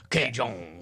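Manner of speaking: drawn-out Frenchified Cajun; exaggerated Cajun twist on the word; mock Cajun accent. Friend teasing the comic's wandering accent, mock Cajun. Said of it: "you're doing a Cajun accent".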